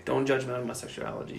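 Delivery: normal talking voice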